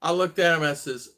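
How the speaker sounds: ordinary talk, nothing marked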